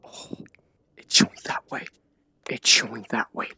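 tense whisper. Real, not acted. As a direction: whisper it while sneaking through. Tense horror moment.

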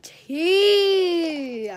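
loud, high cry of tea; shouted tea. Acted. Vlogger shouts out to viewers that gossip is coming, loud high cry. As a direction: belt out the word tea.

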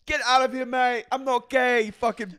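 high, loud hammed-up tirade; mock angry James. James chasing Logan off, high loud mock fury. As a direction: shout him off like an outraged lad. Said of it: "And then James is like".

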